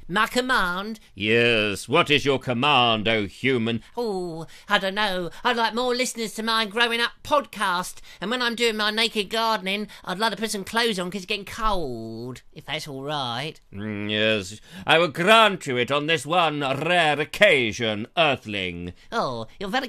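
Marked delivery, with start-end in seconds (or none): 1.1-3.8 low, slow booming genie; grand lower genie voice. Acted. Host plays a genie answering Richard, low and booming. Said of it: "I am the genie of the egg". 13.7-18.9 low, slow booming genie; grand lower genie voice. Acted. Host plays a genie answering Richard, low and booming. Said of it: "I am the genie of the egg".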